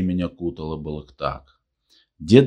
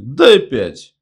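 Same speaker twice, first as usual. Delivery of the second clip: high, loud hearty cry; shouting; 8 semitones higher, 8 dB louder than usual. A narrator's reading. Long man greets Kozlov, shouting. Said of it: "закричал Длинный".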